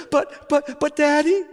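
put-on high child's stammering plea; pleading little girl. Daughter pleads again.

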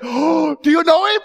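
put-on elderly missionary beaming at the chapel crowd; joyful missionary. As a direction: ask it smiling, overflowing with joy. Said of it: "she said".

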